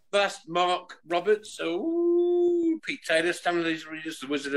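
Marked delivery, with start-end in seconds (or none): 1.6-2.8 delighted ooh. Real, not acted. Reacting to a movie pun.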